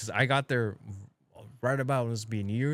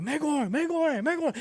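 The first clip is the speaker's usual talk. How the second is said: calling American. Retold or imitated. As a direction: cry megor megor in a much higher voice. Locals shouting "American.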